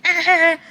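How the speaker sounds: sudden high yelp like hitting a buzzer; short high startled yelp; buzzer shout. Retold or imitated. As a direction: blurt it out like slamming a game-show buzzer. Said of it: "those red buzzer buttons that you're like".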